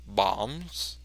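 ordinary talk

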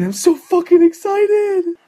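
hammed-up gamer thrill, stretching every word; over-the-top excitement. Gamer imitating the viewer booting up the map, squealing excitement. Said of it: "you're all excited and shit".

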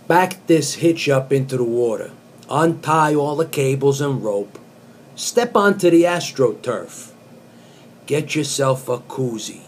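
earnest spoken-word reading of song lyrics; deadpan poet reciting pop lyrics; poetry reading. Acted. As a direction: read it like serious poetry.

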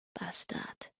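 very soft, whispered mutter; murmured curse. A narrator's reading. Assistant cursing the boss as he disappears, murmured. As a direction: mutter it under your breath. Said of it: "murmelte ich".

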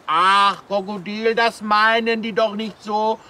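low puppet voice. Acted. Kasperl puppet comforts crocodile.

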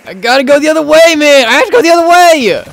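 high, loud frantic yell; panicked yelling. Real, not acted. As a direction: shout it while fleeing, blocked in. Blocked while fleeing zombies.

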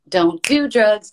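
high, loud, drawn-out goofy slogan; goofy stage-show delivery, big and stretched; goofy showstopper. Acted. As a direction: belt out a hammy school-show warning, slowly. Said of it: "kind of goofy Showstoppers".

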